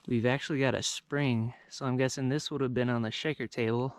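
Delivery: normal talking voice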